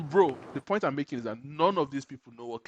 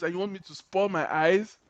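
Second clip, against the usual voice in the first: normal talking voice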